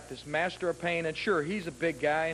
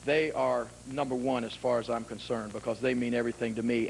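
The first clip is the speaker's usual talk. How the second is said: normal talking voice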